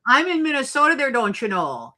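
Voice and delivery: playful, hammed-up Minnesota accent; put-on Minnesotan lilt. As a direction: lay on a folksy Minnesota accent. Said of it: "I was hoping I'd get that accent".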